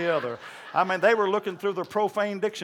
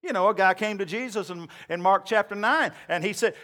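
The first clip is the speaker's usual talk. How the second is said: ordinary talk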